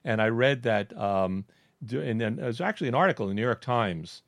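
ordinary talk, nothing marked

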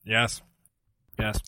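mouth on the mic. Real, not acted. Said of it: "what are you doing to your microphone".

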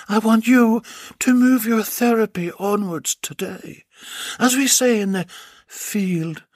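high, commanding woman doctor; a lightly voiced stern doctor; bossy doctor voice. Doctor speaks to Bill.

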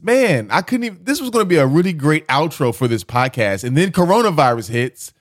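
high, loud, worked-up complaint; exasperated rant. Real, not acted. Host laments his ruined sign-off, exasperated. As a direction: raise pitch and volume in exasperation.

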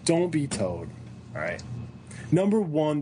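normal talking voice

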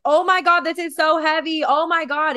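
high, loud pretend distress; straining under weight. Picturing holding a heavy dumbbell, loud strained mock distress. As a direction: groan like the weight is crushing you. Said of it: "You're like".

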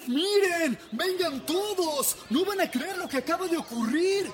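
put-on high, thrilled shouting boy; excited boy. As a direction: call the whole village over. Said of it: "muy emocionado, fue a avisar".

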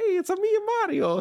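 high, mock-Italian Mario greeting; Italian accent. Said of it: "a little bit of an Italian accent".

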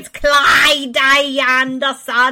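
loud, high, drawn-out name; slow, hammed-up correction; mock correction. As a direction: stretch the name out with exaggerated emphasis.

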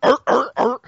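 mock tank mating call. Joking nature documentary about tanks, silly mating growls. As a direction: ham up a goofy animal call. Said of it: "I don't know why they make noises like that".

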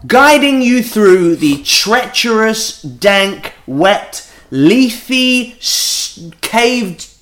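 slow, punchy mock-grand announcement; show intro. Host grandly welcomes listeners to agony-aunt show, mock-grand intro. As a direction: draw out and punch each adjective.

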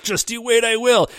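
mean it, make it a promise; determined vow. Retold or imitated. Koji vowing to the rival, a touch fierce. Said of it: "he's like".

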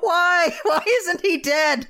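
high, drawn-out mock despair; high-pitched exasperated plea; exasperated wail. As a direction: wail it slowly in comic disbelief. Mock despair at Wilbur's survival.

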